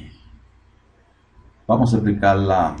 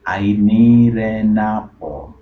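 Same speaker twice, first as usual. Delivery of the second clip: very slow and loud, every word drawn out; slow demo read; slow model line; 3 semitones higher, 7 dB louder, much slower than usual. Shown as a demonstration. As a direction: stretch each word for learners. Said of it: "slow model repetition".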